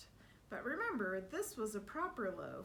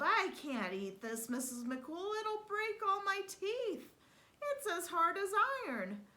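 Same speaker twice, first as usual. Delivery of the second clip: loud, high, outraged giant complaint; yelling giant; 10 semitones higher, 5 dB louder than usual. Acted. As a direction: yell it out, hurt and offended. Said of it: "he yelled".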